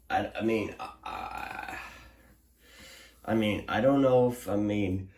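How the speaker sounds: halting, stammering restarts; tongue-tied, words won't come out; stumbling over words. Real, not acted. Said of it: "I can't even speak anymore".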